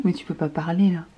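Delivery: ordinary talk, nothing marked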